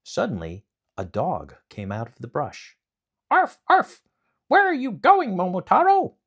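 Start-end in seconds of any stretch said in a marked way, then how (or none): 3.3-6.1 high, loud yappy dog voice; a puppy barking out the question; eager dog. Acted. Dog greets Momotaro on the mountain, high yappy bark.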